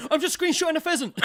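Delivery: high loud flustered blurt; retold panicked defence; panicked excuse.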